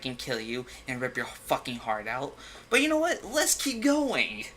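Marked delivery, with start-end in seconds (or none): 2.7-4.5 sarcastic cheer. Real, not acted. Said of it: "sarcastic mock enthusiasm".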